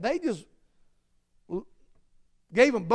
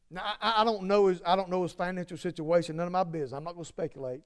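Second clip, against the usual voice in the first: usual speaking voice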